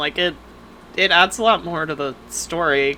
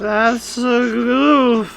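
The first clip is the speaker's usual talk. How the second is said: drawn-out mock goof; 6 semitones higher, 3 dB louder, much slower than usual. Echoing the goof joke.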